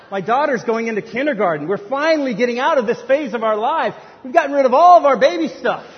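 high, loud protest; exasperated rant. Acted. Father protests to well-wishers, exasperated. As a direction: build in pitch and volume to the last phrase.

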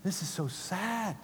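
soft, hushed lament; sad whisper. Acted. Holy Spirit sadly watching the unforgiving servant, hushed. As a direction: grieve quietly over what they're doing. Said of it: "Holy Spirit's just going".

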